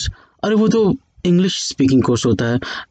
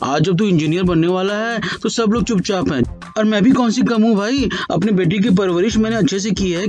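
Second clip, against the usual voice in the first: talkative mom rambling on, a bit higher; chatty mother; 4 semitones higher than usual. Acted. Mother lecturing her son, rambling without pause.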